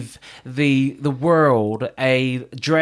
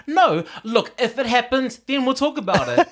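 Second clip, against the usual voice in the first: retold protest; 7 semitones higher than usual. Acts out brunch argument.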